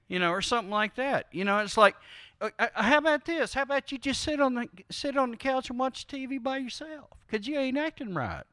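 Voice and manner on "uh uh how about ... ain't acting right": higher, fast, soft and dismissive; mock sulky punisher. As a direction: brush them off to sit alone on the couch. Voicing a sulking punisher.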